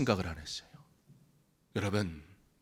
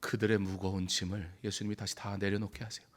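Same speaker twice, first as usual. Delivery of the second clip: ordinary talk, nothing marked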